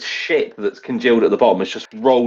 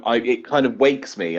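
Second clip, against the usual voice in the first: normal talking voice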